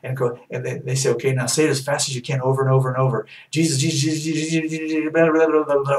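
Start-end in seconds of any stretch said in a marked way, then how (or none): 1.1-3.3 fast, hurried coaxing; fast mock coaching. 3.5-6.0 fast rising mock babble; mock babbling. Mock gibberish tongues.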